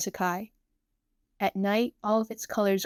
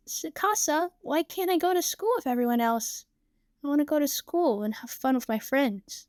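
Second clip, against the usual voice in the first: put-on high little-girl voice; small, plaintive child; little Saki, wistful; 6 semitones higher than usual. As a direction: plead like a sick child missing school.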